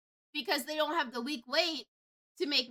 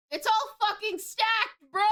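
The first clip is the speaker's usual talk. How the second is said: indignant rant; 8 semitones higher, 4 dB louder than usual. Real, not acted. Ranting about rigged markets.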